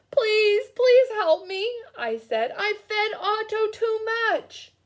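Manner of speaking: loud, high begging; desperate plea for help; pleading. Acted. Boy begging Mister Carp on the phone, loud high pleading.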